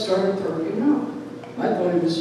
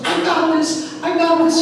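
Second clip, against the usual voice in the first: eager brother; 11 semitones higher, 6 dB louder than usual. Retold or imitated. Brother rushing to help.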